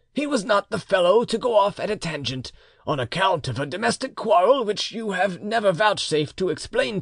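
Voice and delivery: put-on lecturing old uncle. A narrator's reading. Uncle insists his nephew is dead, emphatically.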